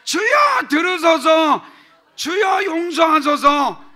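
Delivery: each Lord shouted high and loud; triple cry of Lord. Shown as a demonstration. Pastor demonstrates the threefold cry of Lord, shouting. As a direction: cry out three times to God. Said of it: "다시 한번 해보세요".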